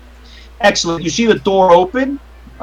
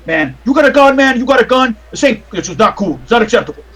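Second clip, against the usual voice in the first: high, loud accusing doorman; agitated doorman; 6 semitones higher, 3 dB louder, faster than usual. Acted. Doorman frisking the detective and accusing him of having a gun. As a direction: confront him while patting him down. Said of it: "he starts patting you down".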